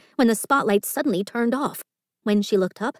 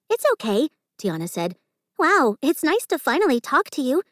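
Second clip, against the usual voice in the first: kind, higher friendly voice; reassuring; 6 semitones higher than usual. Acted. Tiana kneeling beside Margot, gently reassuring. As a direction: put her at ease.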